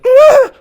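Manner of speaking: very high loud squeal of surprise; startled squeal. A narrator's reading. Boy kissed on the cheek, squealing high and loud. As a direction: yelp like you've been kissed out of nowhere. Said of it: "I let out a noise".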